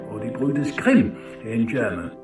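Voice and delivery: ordinary delivery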